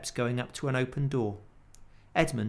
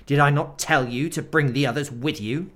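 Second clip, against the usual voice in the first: loud, furious witch; terrible voice; 3 semitones higher, 9 dB louder than usual. Acted. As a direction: rage at the boy. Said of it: "said the witch in a terrible voice".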